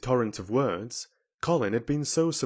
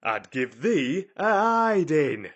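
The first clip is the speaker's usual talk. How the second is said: slow, loud, raised threat; indignant cry; 5 semitones higher, 6 dB louder, slower than usual. A narrator's reading. Ben threatening Mary from the ladder, indignant cry. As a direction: draw the threat out angrily. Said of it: "he cried".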